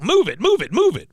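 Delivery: high, loud repeated bursts; mock drill commands. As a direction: punch each order like a play-acted sergeant. Cotton ordering Peggy about.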